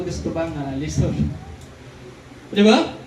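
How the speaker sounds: ordinary talk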